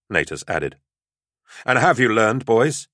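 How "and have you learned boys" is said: put-on raised, higher voice calling out to the boys; calling aloud. A narrator's reading. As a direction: call it out to a group. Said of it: "Xander called aloud".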